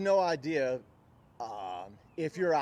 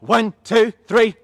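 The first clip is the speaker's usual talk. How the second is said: loud, higher rep count; yelled count; 5 semitones higher, 15 dB louder, slower than usual. Real, not acted. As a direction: shout each number out separately. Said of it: "yell so loud".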